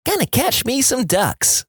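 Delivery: put-on high, slow cheeky kid. Kid answers old man, high kid voice. Kid's reply.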